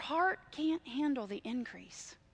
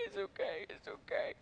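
hushed, gentle reassurance; soothing whisper; 9 semitones higher, 10 dB quieter than usual. Retold or imitated. Husband whispering softly to his crying wife. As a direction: whisper it's okay to calm her.

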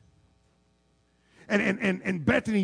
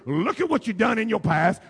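normal talking voice

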